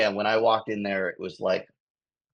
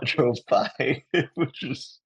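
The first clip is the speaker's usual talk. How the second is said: laughing; 9 semitones higher, much slower than usual. Real, not acted. Laughing at honking drivers.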